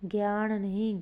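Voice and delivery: ordinary talk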